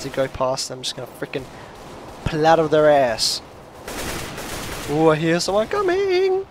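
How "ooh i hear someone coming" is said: soft, high sing-song; hushed high lilt; gleeful anticipation. Real, not acted. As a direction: climb high and soft, drawing out COMING. Lying in wait for enemy.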